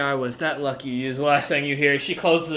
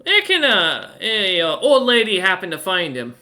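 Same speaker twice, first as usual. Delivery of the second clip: put-on higher, curious old granny; 3 semitones higher, 3 dB louder than usual. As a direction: play the nosy grandmother asking around. GM voices Granny.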